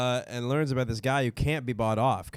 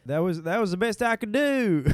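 mock Italian accent; 10 semitones higher than usual. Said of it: "read in Italian accent".

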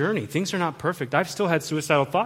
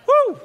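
very high, loud woo; real burst of delight; exultant whoop; 9 dB louder than usual. Real, not acted. Preacher thrilled by counseling, exultant whoop.

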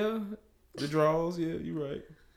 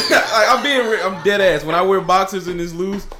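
laughing; 3 semitones higher, 9 dB louder than usual. Real, not acted. Laughing insistence about boxers.

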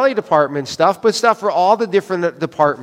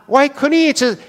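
pitched up, fed-up plea; higher exasperated complaint; 8 semitones higher than usual. Retold or imitated. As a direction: whine it in frustration. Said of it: "repeats his complaint, higher".

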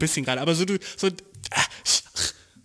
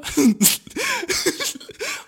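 loud high laugh bursting out; loud laughter; 5 dB louder than usual. Real, not acted. Laughing at the dude's word, loud laughter. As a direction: crack up loudly.

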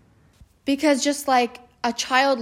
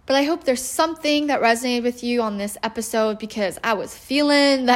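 ordinary delivery

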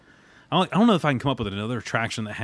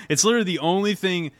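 usual speaking voice

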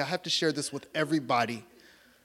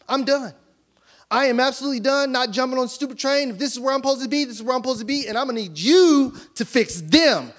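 raised, louder, heated; mock angry rant; 7 semitones higher, 5 dB louder than usual. Pastor fed up with God after the lost job, half-joking fury.

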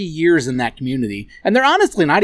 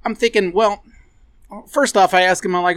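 normal talking voice

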